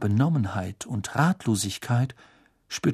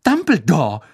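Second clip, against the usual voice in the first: loud, fast indignant reply; upset; 5 semitones higher, 5 dB louder, faster than usual. Acted. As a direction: defend him heatedly.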